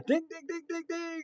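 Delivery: high, soft imitated game show bell; playful bell imitation; prize bell ding. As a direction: chime it like you won a prize.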